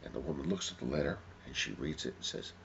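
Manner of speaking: ordinary delivery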